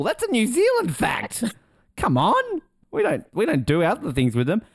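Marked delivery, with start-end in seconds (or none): 0.0-2.6 much higher, playful protest; mock outrage. Real, not acted. Teasing co-host over a stolen fact, high mock outrage. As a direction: cry foul in jest, pitched way up.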